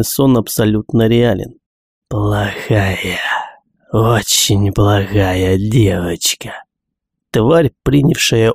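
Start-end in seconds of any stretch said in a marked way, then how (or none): 2.1-6.5 slow, breathy monster whisper; creepy whisper. Acted. Тварь дразнит Гиги. (жутким шёпотом)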